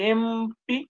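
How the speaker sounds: very slow, spelling out each word; slow dictation. Shown as a demonstration. Teacher dictating the code to a student, very slow. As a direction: dictate it slowly so the student can write.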